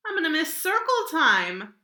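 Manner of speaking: wistful teacher. Acted. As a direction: say it like a fond teacher. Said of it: "said Missus Hartwell".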